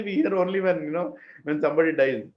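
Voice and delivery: ordinary delivery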